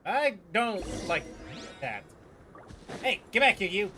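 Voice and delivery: normal talking voice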